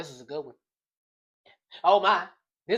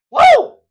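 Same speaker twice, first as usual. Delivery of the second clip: high loud whoop; amped-up yell; woo shout; 14 dB louder than usual. Real, not acted. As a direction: let out a hype whoop. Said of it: "Illness got me amped up".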